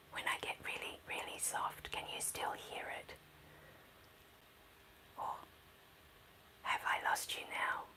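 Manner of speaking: very soft whispered sound check. Shown as a demonstration. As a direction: whisper it, barely audible, checking they still hear you. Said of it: "I'm going to whisper".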